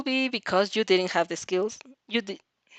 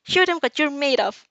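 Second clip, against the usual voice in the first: excited; 6 semitones higher, 4 dB louder than usual. Acted. Mother cheering child on.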